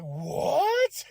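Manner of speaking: high, sudden what; shocked what. Retold or imitated. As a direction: jump out of your seat in disbelief. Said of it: "I even jumped out of my seat".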